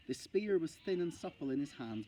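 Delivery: ordinary delivery, nothing marked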